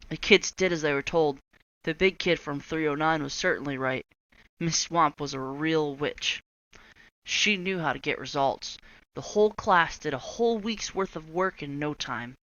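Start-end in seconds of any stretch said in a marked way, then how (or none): none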